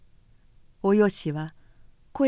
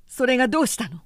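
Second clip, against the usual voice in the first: trembling voice; 3 semitones higher, faster than usual. Acted. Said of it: "おひろの声は震えた".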